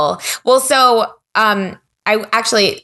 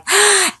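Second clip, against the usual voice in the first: excited gasp. Retold or imitated. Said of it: "every morning she'd be like".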